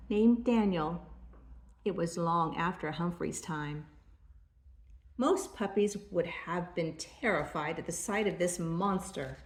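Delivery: ordinary delivery, nothing marked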